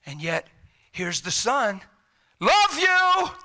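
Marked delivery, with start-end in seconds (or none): 2.4-3.3 sudden high, drawn-out call. Acted. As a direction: call it out brightly over the doubts. The Son calling out love.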